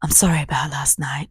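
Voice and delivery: hushed and soft; soft whispered sorry; whispered apology. Retold or imitated. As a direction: murmur it like a half-sorry excuse. Boyfriend's apology voiced.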